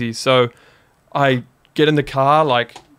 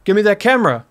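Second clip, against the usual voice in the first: higher-pitched imitated robber's order; retold demand; 7 semitones higher, 3 dB louder, faster than usual.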